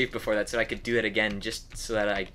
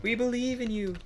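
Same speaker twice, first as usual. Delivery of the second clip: sweet cheerleader voice; 8 semitones higher than usual. Pretend. Cheering enemy on, cutesy.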